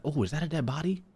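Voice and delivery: usual speaking voice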